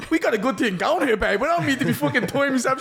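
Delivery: laughing through a teasing quote. Retold or imitated. Laughing as he tells his missus they have a good thing going. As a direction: grin and chuckle through every word.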